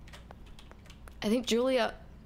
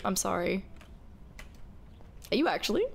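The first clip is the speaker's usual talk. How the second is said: usual speaking voice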